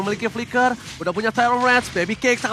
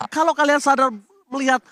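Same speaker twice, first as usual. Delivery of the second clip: ordinary talk, nothing marked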